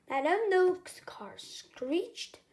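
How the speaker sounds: plain reading voice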